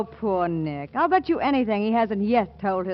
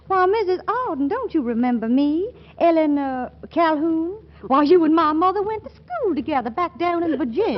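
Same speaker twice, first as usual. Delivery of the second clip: Southern belle; 7 semitones higher, 4 dB louder than usual. Acted. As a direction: play a sweet Southern girl from Virginia. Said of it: "by playing that I'm a little old sweetheart of his from way down yonder".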